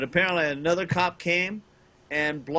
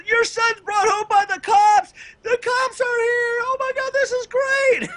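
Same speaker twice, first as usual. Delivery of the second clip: very high, loud imitation of a delighted shriek; mock gleeful screaming; 6 dB louder than usual. Mom's friend announcing the cops to the house, mock gleeful screaming. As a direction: squeal it out as if thrilled by scandal. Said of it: "started laughing and screaming".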